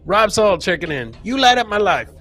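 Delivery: usual speaking voice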